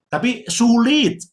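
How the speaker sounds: "tapi sulit" stretched slow and high; slow emphatic "sulit"; drawn-out emphasis. Real, not acted. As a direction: lean hard on "sulit". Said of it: "slow stressed".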